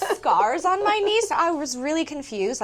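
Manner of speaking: high, loud incredulous burst; amused disbelief. Real, not acted. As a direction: laugh it off in disbelief. Incredulous about the compliment.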